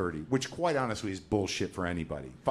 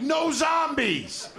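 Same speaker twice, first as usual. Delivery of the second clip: much higher, slow, drawn-out cry; a guest shrieking with relief at the clerk; relieved shriek; 4 dB louder, much slower than usual. Retold or imitated. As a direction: wail it out in giddy relief. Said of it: "I'm like".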